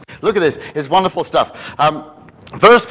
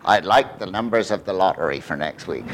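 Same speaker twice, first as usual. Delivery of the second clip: lower, offhand cheeky request; mock greedy asker; 8 semitones lower than usual. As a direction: play a greedy punter.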